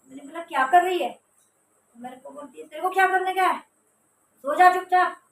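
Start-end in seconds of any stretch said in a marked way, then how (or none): 0.4-1.1 loud call across the room; raised question. Retold or imitated. Narrator calling out to her sister, loud. 2.7-5.2 high, loud, angry command; angry retort. Retold or imitated. Sister ordering narrator to sleep, angry shout. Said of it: "गुस्से में लग रही थी".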